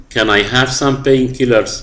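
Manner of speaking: very slow, clear question; teacher dictating a question; slow model reading. Shown as a demonstration. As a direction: spell it out slowly for the class. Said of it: "احفظها".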